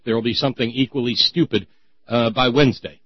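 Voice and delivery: ordinary talk, nothing marked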